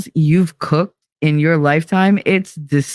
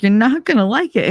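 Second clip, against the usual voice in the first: put-on higher, teasing warning to a child; warning son; 7 semitones higher than usual. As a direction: tell him he won't like it, a little higher. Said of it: "I was like".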